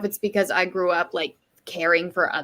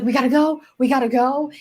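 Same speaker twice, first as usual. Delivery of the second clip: higher, louder mock-panicked urging; retold freaking out; 6 semitones higher, 6 dB louder than usual. Retelling a man rushing after a child's fall, mock panic. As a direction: imitate someone urgently freaking out. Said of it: "like, freaking out".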